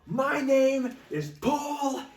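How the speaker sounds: high, loud, slow announcement; Paul Heyman announcing. Retold or imitated. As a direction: draw out the name proudly. Quoting Heyman's ring entrance.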